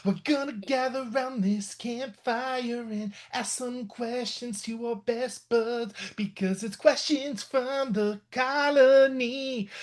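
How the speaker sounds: drawn-out announcer build-up; slow show-opening drawl; slow hammy intro. Acted. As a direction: stretch it like a campfire host.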